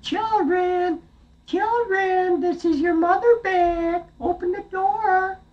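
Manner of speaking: very high, sweet falsetto disguise; a wolf squeaking like a nanny goat; wolf faking mother. Acted. Wolf calls to the goats, faking their mother's voice, very high.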